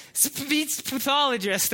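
Speech pathologist said slowly, each syllable pushed out; slowly labor through Speech pathologist; tricky word demo. Shown as a demonstration. Pastor showing how hard Speech pathologist is to say. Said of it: "try and get that word out".

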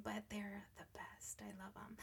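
soft whisper. Real, not acted. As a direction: hush it lovingly. Tender confession about kids.

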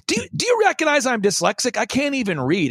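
mock-indignant outburst, pitched high then easing; high-pitched mock yell; imitated yelling. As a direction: imitate a mentor berating a pupil, without full volume. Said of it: "he's, like, yelling at me at this point".

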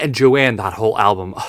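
ordinary talk

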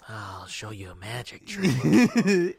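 slow, menacing Joker line; lightly voiced villain, slow and sly; Joker impression. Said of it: "you know that scene with the pencil".

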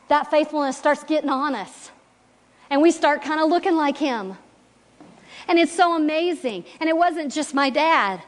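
preaching. Acted. Speaker urging the congregation about faithfulness, fervent preaching. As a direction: preach it with conviction.

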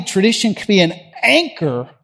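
usual speaking voice

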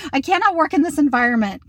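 mock overwrought complaint; dramatic. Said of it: "I'm so dramatic".

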